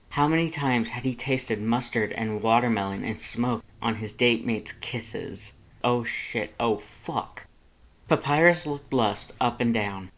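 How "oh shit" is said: ordinary delivery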